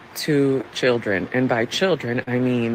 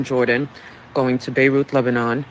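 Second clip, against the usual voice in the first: ordinary talk, nothing marked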